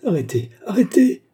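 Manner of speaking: high, loud panicked scream; a woman shrieking in panic. A narrator's reading. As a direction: cry out to stop it, terrified. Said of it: "hurla une voix féminine".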